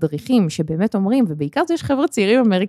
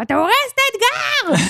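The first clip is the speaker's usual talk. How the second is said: much higher mock scream; screaming at him. Wife screams at Elad for singing during the challenge, high mock shriek. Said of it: "צורחת עליו".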